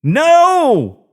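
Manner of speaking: desperate no; scream. A narrator's reading. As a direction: scream to stop the shot. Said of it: "I screamed".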